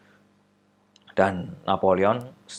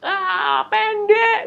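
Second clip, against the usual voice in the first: mocking classmates; 11 dB louder than usual. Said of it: "ketawa mereka semua".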